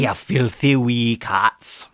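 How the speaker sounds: slow mock Scottish accent. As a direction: scold the wee cats in a Scottish accent. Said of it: "Scottish accent?".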